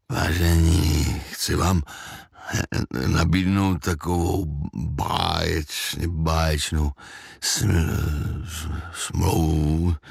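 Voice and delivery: slow, low, dazed slurring; thick-tongued babble. A narrator's reading. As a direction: stumble heavily and stretch the words, tongue too thick. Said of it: "Těžkým jazykem zablekotal". (těžkým jazykem, zpomaleně blekotat)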